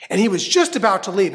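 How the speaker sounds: ordinary delivery, nothing marked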